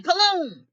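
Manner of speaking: gunshot sound. A narrator's reading.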